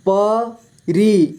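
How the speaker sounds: परी split slowly into प, री; syllable by syllable. Shown as a demonstration. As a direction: stretch and separate प and री in परी. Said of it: "इस तरह से आपको". (परी को तोड़कर धीरे: प, री)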